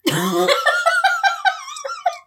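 very high, held-back scream; mock bitter scream. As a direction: fake a long scream without raising the volume. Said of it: "A bitter scream".

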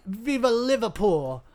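high, hammed-up British cheer; British accent. Host joking about British people, mock British cheer. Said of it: "That's my British accent".

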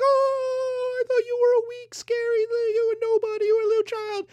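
very high, loud squealing cry; mock panic. Startled beachgoers to the former weakling, squealing mock panic. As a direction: wail it in a hammed-up falsetto. Startled crowd answers the strongman.

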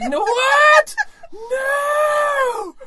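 hammed-up high shriek; crying and screaming. Imitating prank victim demanding a supervisor, screaming mock outrage. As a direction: wail it like a hysterical caller. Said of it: "started crying and screaming at him".

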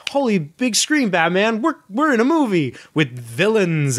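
high, eager sidekick exclamation; excited Robin. Retold or imitated. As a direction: hit it like a thrilled boy wonder. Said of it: "And then Robin goes".

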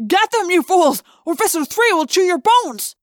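high, loud threatening shout; frantic villain's henchman yelling; furious yelled order. A narrator's reading. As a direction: berate your men as prey escapes. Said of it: "a human voice yelled".

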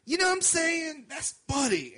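put-on slurring drunk woman, high-pitched. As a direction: play the drunk lady pointing him out. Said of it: "she's like".

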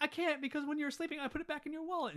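high-pitched imitated excuse; mock frantic confession. Companion confesses where the card went, mock frantic.

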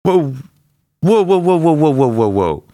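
loud, high whoas winding down; urgent halt. Acted. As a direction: stop him, then ease off. [name] stops Blu-ray pleading.

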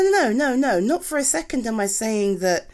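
ordinary talk, nothing marked

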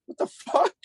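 squeaky high disbelieving what the fuck; high, stunned what the fuck; incredulous. Retold or imitated. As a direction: shriek it in mock disbelief. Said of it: "I'm like".

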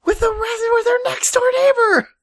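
very high-pitched disbelief; exasperated high squeal; incredulous rant. Real, not acted. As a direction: protest it in a high, strained pitch. Incredulous rant about absurd plot.

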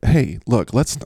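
ordinary talk, nothing marked

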